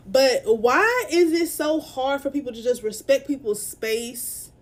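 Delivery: normal talking voice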